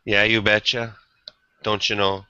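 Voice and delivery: mock Minnesota accent, lightly done; a hint of a Fargo-style Minnesota accent. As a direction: tease the Minnesota way of talking.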